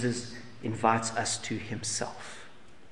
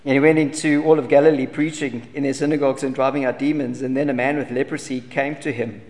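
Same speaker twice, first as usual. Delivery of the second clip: louder, declaiming Bible reading; scripture reading; 7 dB louder than usual. Acted. Preacher reads Mark to the congregation, proclaiming it louder.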